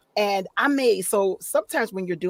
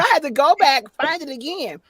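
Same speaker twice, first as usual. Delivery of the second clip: ordinary talk, nothing marked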